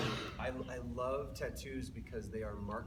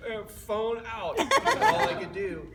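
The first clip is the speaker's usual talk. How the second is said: slow, higher, louder, laughing; 10 semitones higher, 12 dB louder, slower than usual. Retold or imitated. New dad filming the heartbeat, slow and laughing. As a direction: savour it slowly. Retells grabbing phone.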